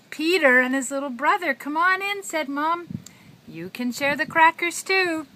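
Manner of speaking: high, loud singsong storytelling; broad singsong kids theatre. A narrator's reading. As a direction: ham it up for a toddler. Reading to a toddler.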